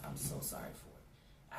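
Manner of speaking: barely voiced, slow, tearful: I'm so sorry for it; whispered apology. Real, not acted. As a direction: breathe out the apology, near tears.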